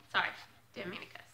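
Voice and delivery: ordinary talk, nothing marked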